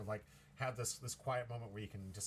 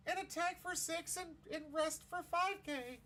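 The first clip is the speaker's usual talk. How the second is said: very high, slow put-on voice; hammed-up high-pitched impression; terrible Carrot voice; slower than usual. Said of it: "that was a terrible Carrot voice".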